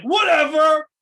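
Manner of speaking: loud, high, drawn-out mock nonchalance; mock dismissive. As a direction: belt out an exaggerated who-cares. Mock shrug of a losing wrestler.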